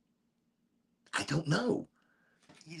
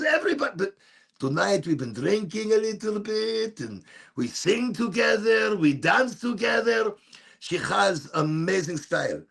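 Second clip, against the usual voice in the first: higher, excited mother on the phone; imitating mom; 5 semitones higher than usual. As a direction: play the excited mom calling her son. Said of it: "She says".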